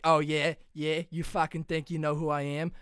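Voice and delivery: loud, swaggering Boston tough guy; cocky Boston genius. Acted. Smart-aleck patient to his therapist, loud Boston cockiness. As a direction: take on the shrink, loud and cocky. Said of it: "be like Good Will Hunting".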